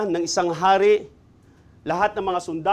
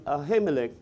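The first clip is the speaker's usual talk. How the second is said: usual speaking voice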